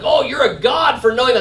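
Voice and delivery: hammed-up admiring exclamation; imitated naive awe. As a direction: gush like an impressed outsider. Imitating an impressed, naive person.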